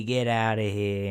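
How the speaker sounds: slow mock Italian-American brush-off; Italian impression. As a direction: wave them off in an Italian-American accent. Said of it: "That's why I went into my Italian impression".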